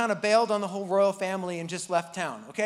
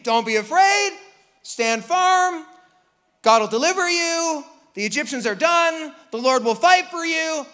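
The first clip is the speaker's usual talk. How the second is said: loud high preaching; rally them like a preacher; 7 semitones higher, 6 dB louder than usual. Retold or imitated. Moses preaching to the people, loud and high. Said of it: "It's good preaching".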